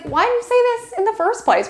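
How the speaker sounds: high, hammed-up exasperated question; mock a film character's anguished why; dramatic movie line. Lover reproaching the other in a movie, high mock anguish. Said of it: "It's like".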